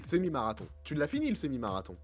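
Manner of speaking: ordinary talk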